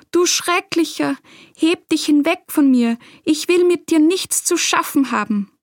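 high-pitched cry warding off the devil; abwehrender Ausruf. A narrator's reading. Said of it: "Abwehrend streckte der Meister seine Hände aus und rief". (abwehrend und mit erhobener Stimme gerufen)

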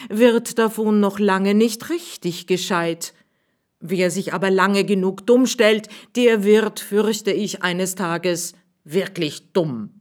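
a worried schoolmaster, lecturing; schoolmaster's voice. Acted. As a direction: warn them with misgivings.

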